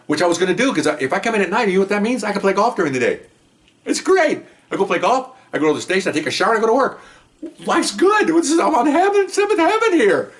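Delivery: gloating like a man who has found paradise; giddy delight. Retold or imitated. Gleeful about night shift golf.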